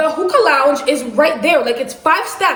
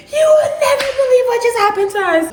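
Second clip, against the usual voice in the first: breathless excitement; 10 semitones higher than usual. Retold or imitated. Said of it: "we're like".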